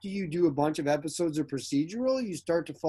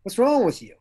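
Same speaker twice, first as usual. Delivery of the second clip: higher, louder exasperated question; irritated demand; 7 semitones higher, 7 dB louder than usual. Retold or imitated. Director scolding the actor on set, irritated loud demand.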